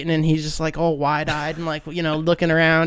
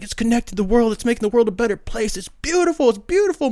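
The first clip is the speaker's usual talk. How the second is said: imitating Eddie; 4 semitones higher than usual. Eddie voiced raving about internet.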